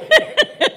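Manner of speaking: shrieking burst of laughter; loud high laugh. Real, not acted. After her own gushing quote, a loud shrieking laugh.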